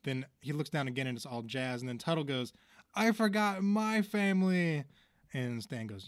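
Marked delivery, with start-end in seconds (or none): 3.0-4.9 mock anguished cry. Tuttle realizes he forgot his family, mock anguished cry. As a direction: wail it in mock distress.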